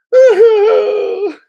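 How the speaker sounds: a sudden, very loud, high cackle; loud burst of laughter. Real, not acted. Catching himself oversharing about back pain, loud cackle.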